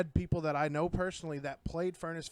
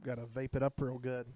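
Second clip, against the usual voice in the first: usual speaking voice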